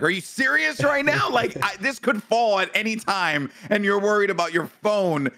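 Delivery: high-pitched, incredulous scolding; retold outrage, voice shooting up; indignant disbelief. Acted quarrel with wife about phone.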